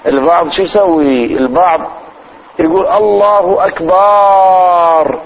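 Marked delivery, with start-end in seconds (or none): 2.9-5.2 الله أكبر with أكبر stretched very slowly; wrong drawn-out takbir. Shown as a demonstration. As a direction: drag out أكبر far too long. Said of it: "يمدها". (مدّ خاطئ للتكبير)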